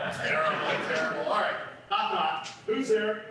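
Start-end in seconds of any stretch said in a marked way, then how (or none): none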